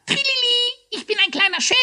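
very high squawk imitating a cheer; squeaky hare quoting. A narrator's reading. Hare demonstrates the ghost's joking cry, squawking. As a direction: mimic the ghost's trill, loud and squeaky. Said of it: "ruft er immer".

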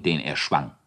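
plain reading voice

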